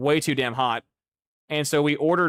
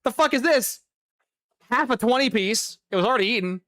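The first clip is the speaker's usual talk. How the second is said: sharp high burst of disbelief; a retold yelp of disbelief at the bag; shocked outrage; 7 semitones higher than usual. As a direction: recoil in disgusted shock. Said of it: "I'm like".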